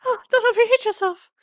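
very high, prissy scolding; shrill mock fret, way up; fussy high mock voice. Mock fussy aside to character.

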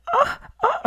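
high-pitched happy shout; high excited yelp. Retold or imitated. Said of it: "le hacía".

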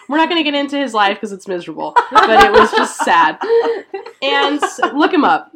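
giggling through the words; laughing. Real, not acted. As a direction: laugh while you talk. Laughing at the sad life.

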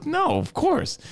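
high, indignant of course; incredulous. Retold or imitated. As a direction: act offended at the question. Brother's incredulous reply, very high.

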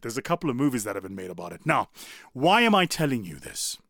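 ordinary delivery

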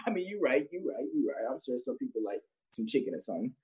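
ordinary delivery

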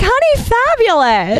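camp and showy, pitch swooping up high; Cam from Family Guy. Acted. Showing how they act flamboyant, camp and high. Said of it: "walk around like Cam from Family Guy".

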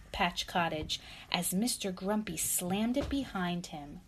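ordinary delivery, nothing marked

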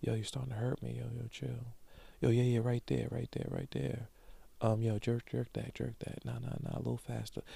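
breathy whispered bedroom voice; sexy voice. Retold or imitated. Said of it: "But you have to say in your sexy voice".

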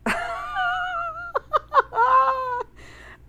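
loud high shriek of laughter; burst of laughter. Real, not acted. Host bursting out laughing on air at listener message. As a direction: cracks up with a squealing laugh. Reading a funny message aloud.

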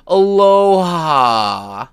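high, slow sneering mock voice; snide mock of the court; mocking imitation. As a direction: ham up a smug judge. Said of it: "flagrantly say".

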